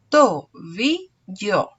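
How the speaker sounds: Tobillo said slowly as to-bi-llo; slow syllables. Shown as a demonstration. Teacher showing pupils the syllables, very slow. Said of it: "Aquí está escrita la palabra tobillo".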